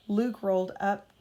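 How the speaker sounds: ordinary delivery, nothing marked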